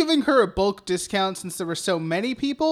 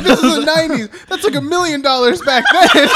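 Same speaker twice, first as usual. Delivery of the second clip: higher and louder, laughing incredulously; laughing mock quote; 7 semitones higher, 8 dB louder than usual. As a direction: mimic an overblown claim through laughter. Laughingly mimics friend's exaggerated claim.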